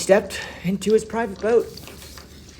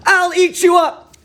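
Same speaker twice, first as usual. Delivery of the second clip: put-on high, loud, wild little boy yell; defiant shout; 14 semitones higher, 8 dB louder than usual. Max yells back at his mother, loud and defiant. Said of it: "And Max said".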